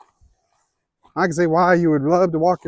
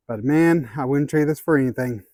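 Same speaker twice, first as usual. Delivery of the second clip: ordinary delivery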